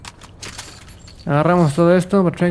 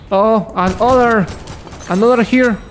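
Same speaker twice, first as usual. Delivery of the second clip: ordinary talk, nothing marked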